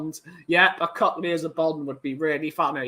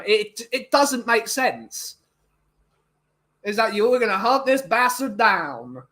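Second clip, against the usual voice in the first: ordinary talk, nothing marked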